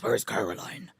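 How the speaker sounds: very low, rough Batman impression. Swapping in a game character's name, low Batman voice. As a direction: drop right down into Batman. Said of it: "Amazing Batman voice".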